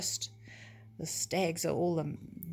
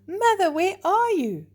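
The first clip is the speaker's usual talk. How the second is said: high, loud desperate call; 11 semitones higher, 7 dB louder than usual. Acted. Lost fawn calls for his mother, loud and desperate. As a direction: call for her across the forest.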